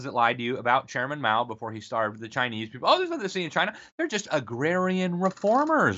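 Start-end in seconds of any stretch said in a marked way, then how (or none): 2.9-6.0 high sarcastic mock innocence. Host mocking reporters excusing Mao, high sarcastic voice. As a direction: play the smug apologist, pitched high.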